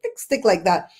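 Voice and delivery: ordinary talk, nothing marked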